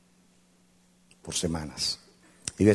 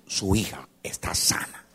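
whispered declaration; slower than usual. Acted. Pastor declaring healing.

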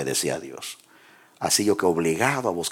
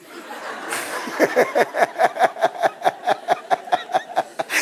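high breathless laugh; 3 dB louder than usual. Real, not acted. Laughing at his own joke.

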